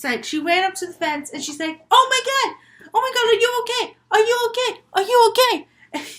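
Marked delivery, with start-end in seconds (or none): 1.9-6.1 high, loud, frantic check on someone hurt; acted shriek of worry; panicked shouting. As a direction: call out in alarm, voice pitched way up. Sister panics over injured brother.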